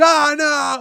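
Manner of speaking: mock shriek of fake panic; over-the-top freakout. Retelling the heroine's panic, hammed-up shriek. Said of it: "freaking out, like, over the top".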